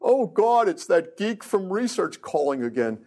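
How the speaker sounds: imitating a fed-up New York receptionist; thick New York accent. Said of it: "in a real thick New York accent".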